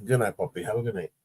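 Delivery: ordinary delivery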